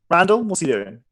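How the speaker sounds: ordinary delivery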